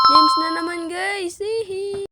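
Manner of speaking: loud, high gleeful cheer; excited. Real, not acted. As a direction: cheer at the find. Finding gems, excited.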